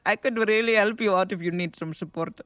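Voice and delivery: put-on tech support call in an Indian accent; chirpy helpline agent, Indian accent. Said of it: "why am I so good at the Indian accent?".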